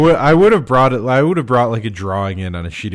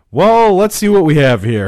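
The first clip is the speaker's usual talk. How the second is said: mock helpful expert; 7 semitones higher, 6 dB louder than usual. Acting out own response to request.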